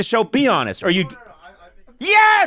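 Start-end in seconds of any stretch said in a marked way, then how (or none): 2.0-2.5 high, loud burst of vindication; a real victorious yell; triumphant shout. Real, not acted. Host triumphant at audience member's answer.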